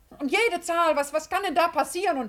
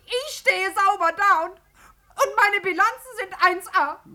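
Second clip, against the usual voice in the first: ordinary talk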